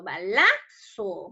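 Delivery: "Balazo" slowed, with the middle syllable la punched; stress on LA. Shown as a demonstration. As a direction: say "Balazo" slowly as ba-LA-zo. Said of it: "la mayor fuerza de voz debería estar en la".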